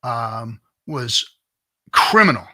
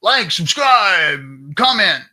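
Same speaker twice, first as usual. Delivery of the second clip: high, loud, drawn-out hype call; mock hype streamer voice; 7 semitones higher, 7 dB louder, slower than usual. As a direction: ham up the like-and-subscribe plug. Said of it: "I, I never say that".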